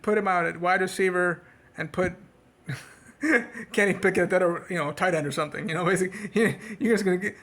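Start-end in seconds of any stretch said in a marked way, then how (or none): 3.2-3.7 high short burst of laughter; a quick amused laugh. Real, not acted. Laugh alone on line. 5.7-7.4 talking through laughter; laughing. Real, not acted. Laughs at absurd lineup.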